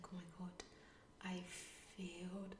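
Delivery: hushed, dread-filled whisper; panicked whisper. Retold or imitated. Recalling her thought after seeing her husband's pale face, a panicked whisper. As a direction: breathe it out like you just realised you failed. Inner panic about the exam.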